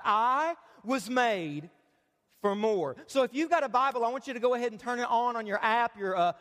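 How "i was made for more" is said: each word slow and weighted; slow emphatic statement. Shown as a demonstration. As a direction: read it slowly, like a motto to remember. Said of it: "Let's read it out loud".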